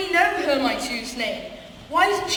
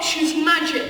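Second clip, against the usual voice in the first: usual speaking voice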